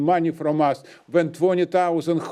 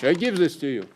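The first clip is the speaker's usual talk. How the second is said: normal talking voice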